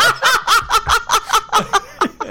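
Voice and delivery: high burst of laughter. Real, not acted. Laughing at choking Steve.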